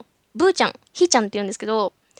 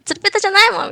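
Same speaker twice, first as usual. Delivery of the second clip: pouty protest; 7 semitones higher, 6 dB louder, faster than usual. Retold or imitated. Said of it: "みたいなこと言って".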